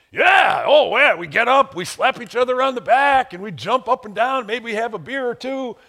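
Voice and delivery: imitating fired-up fans, high and loud; hammed-up enthusiasm as the guys; football fans bragging about cheering; excited fans. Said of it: "So you're pretty excited about that?".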